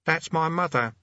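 put-on high teenage boy voice. A narrator's reading. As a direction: play a nervous young boy.

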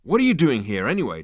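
high, loud challenge; demanding. A narrator's reading. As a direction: demand an explanation. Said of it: "demanded Zaphod".

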